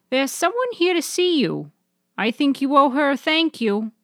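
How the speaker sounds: high, prim grandmother voice. Acted. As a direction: play a gently firm grandmother.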